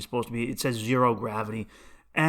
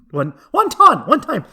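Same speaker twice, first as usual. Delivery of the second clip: loud, high, badly faked Central American accent; 11 semitones higher, 10 dB louder than usual. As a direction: keep fumbling for a mock Central American accent. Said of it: "Central American accent".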